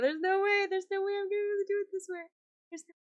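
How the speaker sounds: high, rapid squeal of despair; rising high-pitched fretting; mock panic. Real, not acted. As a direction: rattle it out high and fast in disbelief. Panicking over a fiddly doll task.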